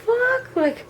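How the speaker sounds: high, incredulous retold protest; acted-out indignant astonishment; outraged disbelief. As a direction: stretch it out, rising in disbelief.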